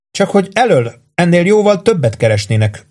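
high-voiced, guarded objection; reserved. A narrator's reading. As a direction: hold back politely. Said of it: "mondta tartózkodó hangon Missus Kipfer".

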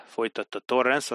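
plain reading voice

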